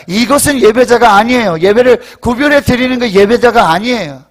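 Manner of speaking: loud, emphatic rebuke; full-force preaching. Real, not acted. Preacher correcting those who pick services, forceful. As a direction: drive the rebuke home from the pulpit.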